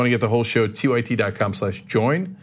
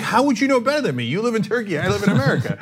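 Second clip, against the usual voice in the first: higher-pitched, quick mock protest; indignant retort; 8 semitones higher, faster than usual. Teen arguing with his cousin about America, indignant. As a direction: argue back in exasperated disbelief.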